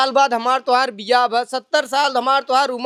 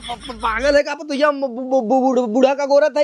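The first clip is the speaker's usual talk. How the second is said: stammering on first sounds; flustered stammer. Acted. Old man caught peeping makes excuses, flustered stammer. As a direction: trip over the words defensively.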